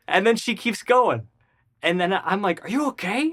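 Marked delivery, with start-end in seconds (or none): 2.6-3.3 high-pitched acted concern; high, alarmed question; retold alarm. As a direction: imitate a worried check on someone. Alarmed check after the crash.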